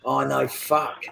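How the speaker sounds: normal talking voice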